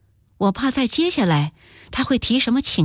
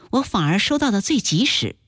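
usual speaking voice

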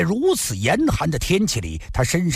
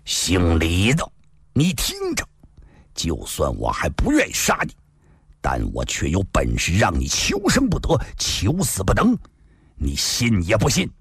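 angry threat; 7 semitones lower than usual. A narrator's reading. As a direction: bark a threat at the prisoner. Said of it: "怒声喝道". (怒声喝问)

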